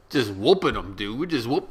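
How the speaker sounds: ordinary talk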